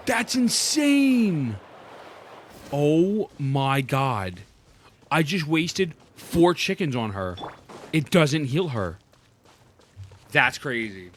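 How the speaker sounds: ordinary talk, nothing marked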